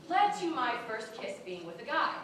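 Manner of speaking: normal talking voice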